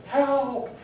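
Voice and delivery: high, unfamiliar voice crying out; a distant voice calling for help. Retold or imitated. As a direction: answer from below with a high yelp. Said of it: "And the character says".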